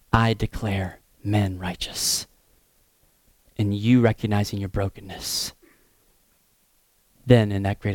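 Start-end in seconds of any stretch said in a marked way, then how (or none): 0.1-2.3 solemn declaration. Acted. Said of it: "being God, says to us".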